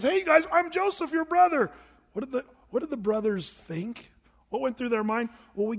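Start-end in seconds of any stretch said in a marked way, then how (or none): 0.0-1.7 higher, louder, beaming announcement; joyful reveal. Pretend. Joseph revealing himself to his brothers, bright and loud. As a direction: burst out with the happy news. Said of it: "when Joseph says".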